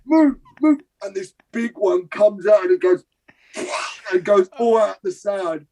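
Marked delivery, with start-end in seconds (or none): 0.0-0.8 high, loud muffled yell of move; urgent muffled cry to clear the way; mouth-full shout. Retold or imitated. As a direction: shout through a mouthful of sick. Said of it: "He's like".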